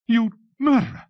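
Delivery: high, loud, anguished scream; screaming in tearful outrage; screamed accusation. Acted. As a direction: scream it at him, drawing out the words. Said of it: "she screamed".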